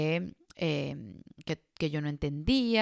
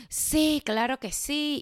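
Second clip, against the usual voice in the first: much higher, chirpy fake yes; mock eager agreement; 9 semitones higher, much slower than usual. Caving in to someone insisting on paying, mock eager yes. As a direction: sing out an overeager yes.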